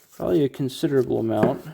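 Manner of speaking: ordinary talk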